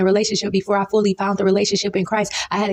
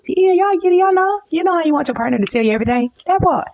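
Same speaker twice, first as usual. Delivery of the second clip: excited high aside; 8 semitones higher than usual. Real, not acted.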